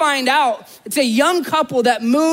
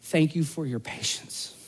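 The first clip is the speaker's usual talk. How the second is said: soft gravestone words; 8 semitones lower, 11 dB quieter than usual. Retold or imitated. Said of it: "And she's just".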